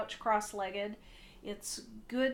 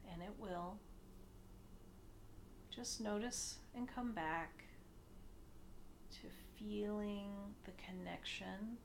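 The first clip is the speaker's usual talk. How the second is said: soft meditation voice with long pauses, growing quieter; hushed, unhurried guiding, sinking softer as it goes; guided meditation; 3 semitones lower, 10 dB quieter than usual. Acted. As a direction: ease them gently into stillness.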